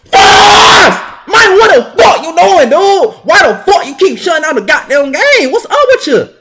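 high, loud angry yell; furious outburst. Real, not acted. Gamer raging at the game shutting down, furious yell.

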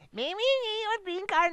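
high, loud put-on guard voice; border guard voice. Border guard stopping Bob's bus, mock official. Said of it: "they say".

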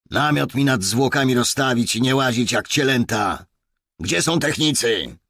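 loud, high-pitched angry bellow; yelling orders. A narrator's reading. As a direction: yell commands at the officers around you. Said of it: "wrzasnął". (wrzaskliwe wydawanie rozkazów)

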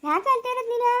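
put-on high, cooing questions to a crying child; baby talk. Asking babies why they cry.

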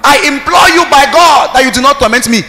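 loud, high demon voice crying out; demon's plea. Acted. Preacher voicing the demon, loud pleading cry. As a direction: shout it as the tormented demon begging.

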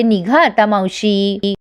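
"निघा आता मावशी" drawn out very slowly; mock-stern, slow send-off to the aunt; slow cold dismissal. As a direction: dismiss her slowly, word by word. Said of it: "Pinky दार उघडते आणि म्हणते".

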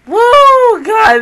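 high, loud whoop; excited whoop. Real, not acted. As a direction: cheer it out.

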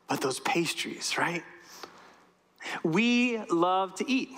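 slow conspiratorial whisper. Real, not acted. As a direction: let the congregation in on a secret. Whispering a shared guilty pleasure.

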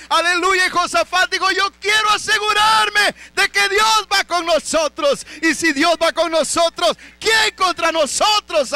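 high, loud shouted preaching; fiery sermon climax; preaching at full force. Acted. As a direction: cry it out to the congregation. Preaching Josafat's resolve aloud.

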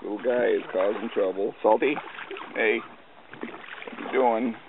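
ordinary talk

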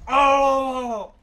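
high, loud startled cry; startled yell. Real, not acted. Gamer startled in the water, loud yelp. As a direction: yelp at the sudden scare. Sudden scare.

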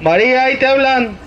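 loud holler across a crowd; calling out. Pretend. Volunteer performs the clown's cue, calling out loudly. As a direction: shout the cue out at full voice. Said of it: "Me gritas".

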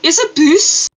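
mock French accent on "boost", said like "bus"; lightly put-on French accent, "boost" as "bus". Streamer jokes to chat about "boost" in a French accent. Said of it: "Boost is like saying bus with a French accent".

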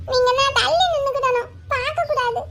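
put-on high-pitched childish instructions; bossy small boy. Boy telling teacher not to look, bossy child voice. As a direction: lay down the rule like a little kid.

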